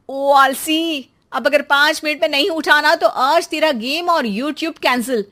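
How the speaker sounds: mother waking her lazy son, scolding loudly. Acted. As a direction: drag out the first words, then threaten.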